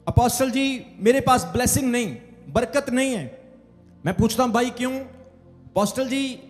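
ordinary delivery

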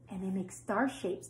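ordinary delivery

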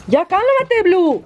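sharp, high, loud "cálmate" at the dog; scolding the dog. Real, not acted. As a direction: snap at the dog to settle down.

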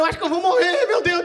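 high, loud mock despair through laughter; wife on the ski slope, hammed-up despair; desperate. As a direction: wail it like she's about to die.

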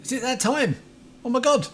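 high, flustered exclamations; overexcited. Real, not acted. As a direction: burst out in real excitement. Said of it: "I'm just obviously overexcited".

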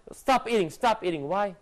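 ordinary delivery

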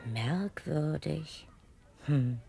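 slow, soft puzzled musing. Acted. Headmistress puzzles over the twins, slow and soft. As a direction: wonder to yourself.

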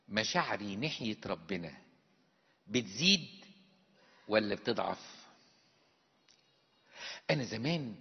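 ordinary delivery

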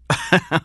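short, high, loud-ish laugh. A narrator's reading. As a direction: break into a brief acted laugh. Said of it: "He laughed".

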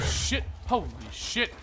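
usual speaking voice

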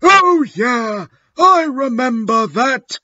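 high-pitched, hammed-up character voice; trying to sound gothic. A narrator's reading. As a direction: put on a high, affected voice. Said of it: "trying to be all gothic".

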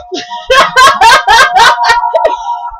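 high shrieking laugh. Real, not acted. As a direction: cackle high at the joke. Laughing at friend's story.

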